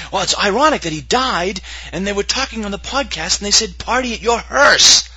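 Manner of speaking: high voice building to a loud punchline; mock newscaster irony. Host imagining reports of his death, mock-ironic high voice. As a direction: tell it high, then stretch the last words loud.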